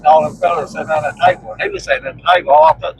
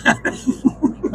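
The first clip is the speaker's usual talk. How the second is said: breathy laugh; 11 semitones higher, 5 dB quieter than usual. Real, not acted. Laughs at the killing remark.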